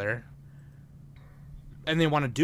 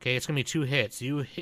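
usual speaking voice

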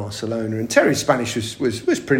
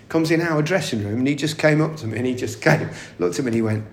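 usual speaking voice

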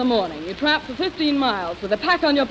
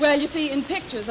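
ordinary talk, nothing marked

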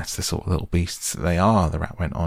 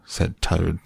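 ordinary delivery, nothing marked

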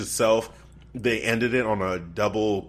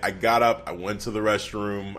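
usual speaking voice

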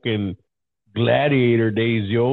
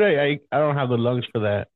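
ordinary delivery

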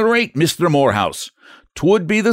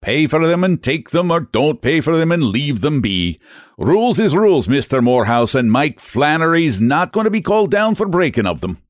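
raised, higher Irish brogue; stubborn clerk laying down the law; angry Irish agent; 6 semitones higher than usual. Acted. Angry agent argues at counter.

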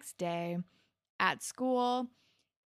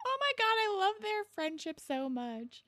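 very high-pitched fangirl gush; high, adoring outburst; 12 semitones higher than usual. Retold or imitated. Gushing over the characters' friendship.

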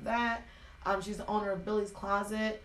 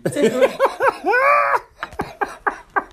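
big high-pitched burst of laughter; loud high laugh; 14 semitones higher, 11 dB louder than usual. Real, not acted.

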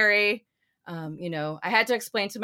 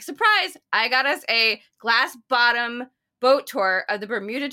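retold surprise announcement; 3 semitones higher, 6 dB louder than usual. Friend reveals a midnight boat tour, mock excitement. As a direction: burst out brightly, then settle a little.

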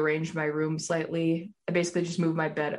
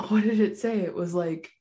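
ordinary talk, nothing marked

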